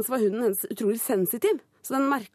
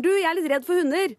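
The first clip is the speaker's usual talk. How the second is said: raised call across the street; nervous shout to a stranger; 7 semitones higher, 3 dB louder than usual. Retold or imitated. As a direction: call out to the dog owner. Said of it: "så roper jeg sånn til eieren".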